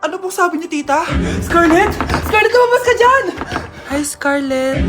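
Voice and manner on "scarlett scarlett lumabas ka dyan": loud, high call; mother shouting for her daughter to come out. Acted.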